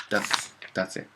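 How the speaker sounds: ordinary delivery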